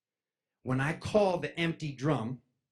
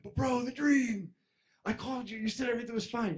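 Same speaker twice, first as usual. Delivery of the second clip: high pleading whine; 10 semitones higher than usual. Retold or imitated. High whiny plea to friend who's leaving.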